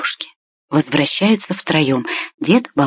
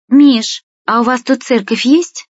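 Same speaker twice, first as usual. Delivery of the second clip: higher-pitched, lightly voiced girl asking; Masha's sudden question; 7 semitones higher, slower than usual. A narrator's reading. Girl suddenly asking her friend Миша about a church, lightly voiced higher question. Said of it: "вдруг спросила Маша".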